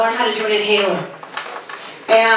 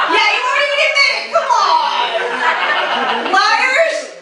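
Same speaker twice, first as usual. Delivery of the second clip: teasing; 10 semitones higher, slower than usual. Acted. Teasing audience who won't admit age.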